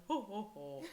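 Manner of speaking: imitated jolly ho-ho-ho; Santa laugh. Friend imitating an awkward teacher's laugh, Santa laugh. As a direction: mimic a Santa-style chuckle. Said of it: "a Santa laugh".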